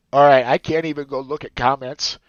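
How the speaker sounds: holding back tears, voice tight; fighting real tears while talking; crying. Real, not acted. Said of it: "you're crying".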